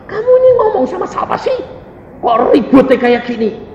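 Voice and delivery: high-pitched puzzled roommate; teman sekamar keheranan. Retold or imitated. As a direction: put on a high voice, complaining and baffled. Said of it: "teman saya sekamar itu bilang". (suara tinggi teman yang heran)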